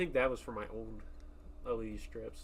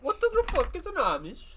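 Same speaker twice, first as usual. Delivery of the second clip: mock Swedish voice; 8 dB louder than usual. Echoes as mock Swede.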